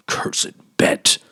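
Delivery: hushed, breathless curse; muttered curse. A narrator's reading. Old banker cursing the bet, muttered. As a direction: spit it out under your breath. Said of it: "muttered the old man".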